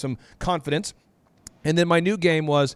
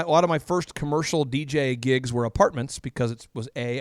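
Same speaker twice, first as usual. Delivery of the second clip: normal talking voice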